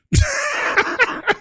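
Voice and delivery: high wheezy burst of laughter; real high-pitched cackle; laughing. Real, not acted. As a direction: crack up at your own bit. Cracks up after his mock crying.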